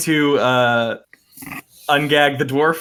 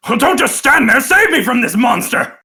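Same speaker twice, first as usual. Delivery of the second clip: loud, high-pitched plea for rescue; panicked dwarf; 11 semitones higher, 7 dB louder than usual. Acted. Captive dwarf begs to be saved from a monster, panicked yell. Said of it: "looks to you and goes".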